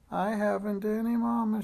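put-on high childish wail; acted high-pitched lament; lifted-up voice. A narrator's reading. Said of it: "she preferred to lift up her voice".

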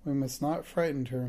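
ordinary delivery

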